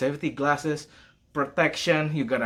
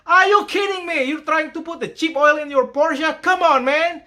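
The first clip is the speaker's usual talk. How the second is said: high, loud exasperated shout; incredulous outburst; 14 semitones higher, 6 dB louder than usual. Retold or imitated. Mechanic yelling at customer over cheap oil, loud disbelief. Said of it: "I was like".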